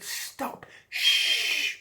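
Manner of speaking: whispered urgent hush. Acted. Third dolphin hushing the other two, an urgent whisper. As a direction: hush them at once in a whisper.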